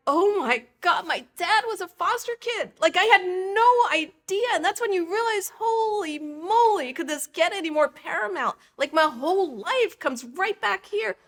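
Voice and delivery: loud, high-pitched amazement; reliving a stunning revelation, voice soaring; astonished realization. Retold or imitated. Retold astonishment at father's foster revelation.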